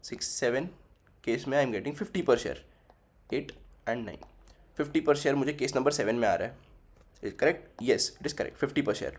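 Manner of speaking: usual speaking voice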